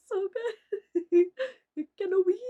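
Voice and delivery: high, loud laughing delight; cracking up at her own joke. Real, not acted. Laughing at meme.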